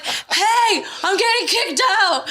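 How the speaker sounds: high-pitched yelling through laughter; laughing, high mock holler. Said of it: "and I'm yelling".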